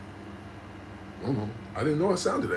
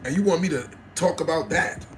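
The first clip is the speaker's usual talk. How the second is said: ordinary delivery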